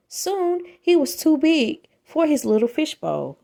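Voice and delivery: usual speaking voice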